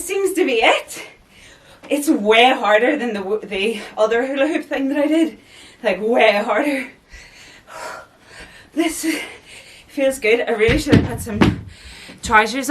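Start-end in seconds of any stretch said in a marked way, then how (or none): none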